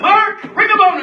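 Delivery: high, loud winner call; loud announcement. Real, not acted.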